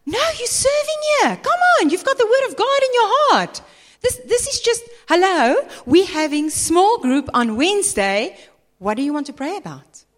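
high, loud, incredulous push; mock-exasperated pastor pressing her to serve; pushy insistence. Pastor pressing a hesitant member, high and loud mock exasperation.

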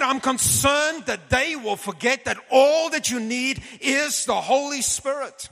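high, loud imitation of a fervent preacher; preaching voice. Retelling Reinhard's preaching to him.

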